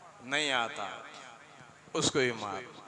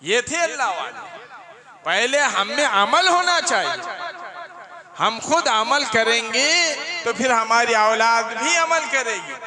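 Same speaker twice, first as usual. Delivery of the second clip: higher, louder sermon voice driving the point home; forceful preaching; 9 semitones higher, 6 dB louder than usual. Acted. Preacher voicing the saint's answer to the mother, in loud, high preaching. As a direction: proclaim it to the crowd.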